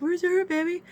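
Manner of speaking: very high mock babying; coddling partner. Partner keeps coddling her, mocked in high babying voice. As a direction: coo it high, imitating him.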